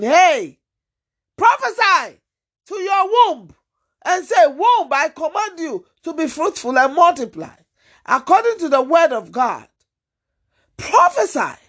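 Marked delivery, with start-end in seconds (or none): 0.0-7.6 commanding divine voice. Acted. 10.8-11.6 high, ringing call; urgent high exhortation. Real, not acted. Preacher urging congregation.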